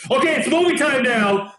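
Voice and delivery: a hint of a stern father; stern dad voice. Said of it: "he did it in a very stern voice".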